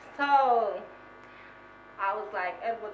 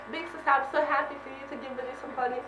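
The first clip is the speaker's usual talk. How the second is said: ordinary talk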